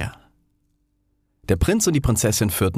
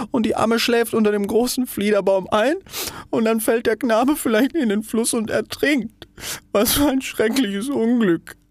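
milk an imagined tragedy; self-pitying rocket. Acted. Rocket grieves over an imagined drowned prince, high and theatrical.

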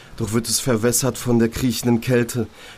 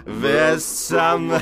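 mocking inner voice; 6 semitones higher, much slower than usual.

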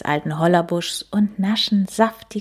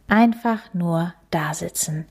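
usual speaking voice

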